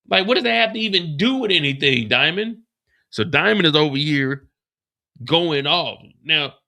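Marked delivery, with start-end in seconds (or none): 0.1-2.6 disbelief. Real, not acted. Host reacting loudly.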